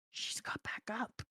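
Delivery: hushed, soft aside; barely voiced dramatic whisper; whispered realization. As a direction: whisper it under your breath. Acted aside in battle.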